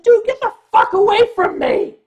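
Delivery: telling the biter off, loud furious shout; retold shout. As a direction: shove him off with your voice. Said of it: "I turned around and I said".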